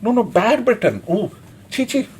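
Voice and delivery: put-on tut-tut like scolding a child; mock scolding. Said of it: "we were scolding British".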